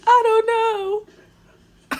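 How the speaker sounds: high, stretched-out, slow, dissolving into laughter; laughing squeal. Retold or imitated. Cousin laughing as she answers, high and drawn out. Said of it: "she was like".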